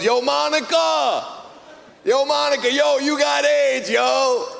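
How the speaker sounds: high, slow calling-out yell across the subway; yelled across the tracks. Retold or imitated. Guy on the train screams across the tracks to Monica: long, high shout. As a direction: holler it across the tracks, drawn out. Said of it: "This guy screams across the tracks".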